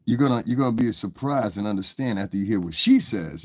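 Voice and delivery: usual speaking voice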